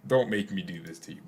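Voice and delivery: usual speaking voice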